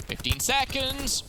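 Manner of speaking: excited commentator drawing it out; tense time call. Real, not acted. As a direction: announce the clock with rising urgency. Calling the ticking clock.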